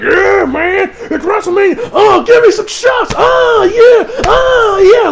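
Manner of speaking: high, loud whooping party bro; mock him as a wasted party guy; hammed-up shots-yelling dude. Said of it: "that Mojo be like".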